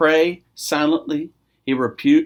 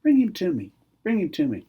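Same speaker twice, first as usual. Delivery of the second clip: calm Jesus; 5 dB quieter than usual. Acted. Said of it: "here's Jesus going".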